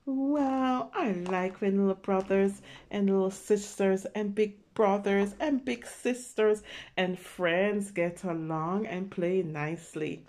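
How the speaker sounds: ordinary delivery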